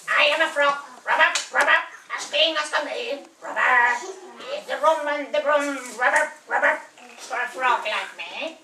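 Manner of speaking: low, quick croaky frog; a frog asking the witch for a ride. Acted.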